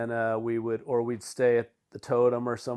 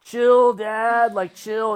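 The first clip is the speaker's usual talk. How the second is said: mock whiny kid; 9 semitones higher, 7 dB louder, much slower than usual. Kid Jake quoted by the dad.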